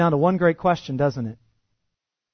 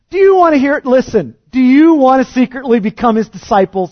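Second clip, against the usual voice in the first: loud, high, sarcastic jab; healed man mocking the Pharisees, loud and high; mocking taunt; 11 semitones higher, 11 dB louder than usual. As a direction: needle them with the question.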